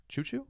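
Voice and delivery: high squeaky choo-choo; train imitation. Shown as a demonstration. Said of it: "how does a train sound?".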